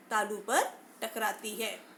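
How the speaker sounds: usual speaking voice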